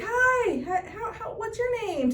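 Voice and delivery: high-pitched mock greeting; imitated young man. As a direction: imitate an over-eager kid saying hello. Imitating the young man introducing himself.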